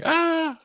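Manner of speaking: high-pitched held "ah"; mock yell. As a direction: yell it as a TikTok gag. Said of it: "TikToks of me yelling".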